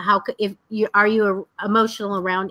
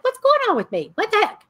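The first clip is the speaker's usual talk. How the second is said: much higher, startled, puzzled; baffled surprise; 12 semitones higher, faster than usual. Retold or imitated. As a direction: caught off guard by your own tears. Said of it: "I'm like".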